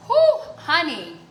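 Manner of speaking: loud, slow "whew, honey" with a high lift; excited exclaim. Real, not acted. Coach promising clients relief, loud stretched exclaim. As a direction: let it burst out, stretched and loud. Big payoff after doing the work.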